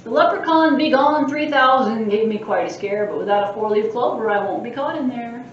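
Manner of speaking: a lightly voiced mischievous leprechaun boasting; cheeky leprechaun. Librarian reading the leprechaun's boasts aloud, cheeky. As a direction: tease the reader like a sprite.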